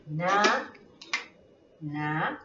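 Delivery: "natation" broken up with long gaps, na... ta... tion; separated syllables. Shown as a demonstration. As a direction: softly isolate each syllable of "natation". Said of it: "Entonces separamos".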